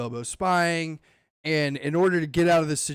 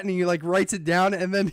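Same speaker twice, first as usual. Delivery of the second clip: normal talking voice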